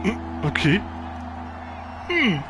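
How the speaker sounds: ordinary talk, nothing marked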